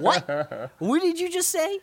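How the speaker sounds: high, loud mock bewilderment; hammed-up incredulous question; baffled. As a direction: act totally lost, voice shooting up. Acted baffled reaction to comedian.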